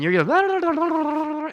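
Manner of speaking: lightly voiced chatty friend, high and drawn out; a hint of a friend chattering on without effort. Friend chatters away while he struggles.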